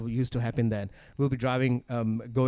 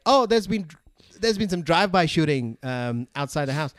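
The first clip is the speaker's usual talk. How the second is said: mom voice; 4 semitones higher than usual. Retold or imitated. Mom warning about shooting.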